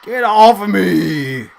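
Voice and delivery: very loud, high, drawn-out shout; hammed-up yell. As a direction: bellow it like a runner shrugging off tacklers. Playing the runner shaking off tacklers.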